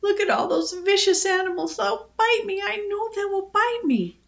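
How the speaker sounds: put-on high-pitched panicky child; whining, fearful little girl; frightened wail. A narrator's reading. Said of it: "she wailed to her daddy".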